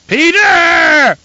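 very high, loud held yell; shouting name. A narrator's reading. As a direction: scream the name out long. Baron shouting again for mentat.

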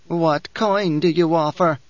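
lightly voiced haughty old priestess; a hint of a proud crone sneering at Paul; old Reverend Mother, disdainful.

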